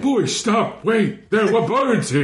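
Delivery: high silly guard voice, laughing; a giggling guard scolding the others; suspicious guard. Acted. Voicing a guard.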